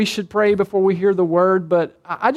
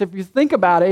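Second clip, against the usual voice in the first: ordinary talk, nothing marked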